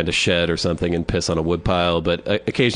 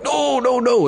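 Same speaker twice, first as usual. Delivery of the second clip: put-on agitated old man; slower than usual. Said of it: "he starts getting agitated".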